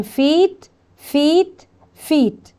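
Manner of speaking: فيت with a stretched "ee"; long E demo. Shown as a demonstration. Teacher drilling long E for pupils, stretched "ee" in فيت. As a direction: hold the "ee" in فيت before the final t. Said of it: "عندي اللونج إي".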